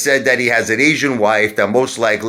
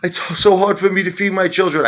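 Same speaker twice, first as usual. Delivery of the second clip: higher whiny mock plea; mock pleading woman; 8 semitones higher than usual. Mocking Veronica's sob story to the viewers, higher, whiny. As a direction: play her as a put-upon mother begging, higher. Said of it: "She's like".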